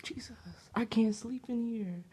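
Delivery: scared whisper. Real, not acted. Alone in her room at night, frightened whisper. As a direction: whisper it so nothing hears you.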